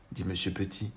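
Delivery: ordinary delivery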